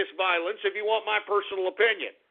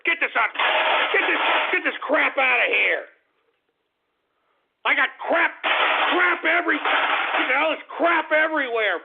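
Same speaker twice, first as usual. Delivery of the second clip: high, loud frantic complaint; irritated yelling, pitched up; exasperated shouting; 7 semitones higher, 4 dB louder than usual. Real, not acted. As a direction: rage at the clutter around you. Ranting about clutter.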